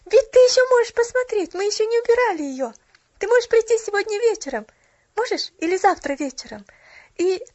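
put-on high, loud, eager child voice; joyful excitement. A narrator's reading. Child inviting the shop girl to see the tree, excited. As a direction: urge the invitation on with delight. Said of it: "воскликнула она радостно".

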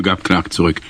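ordinary delivery, nothing marked

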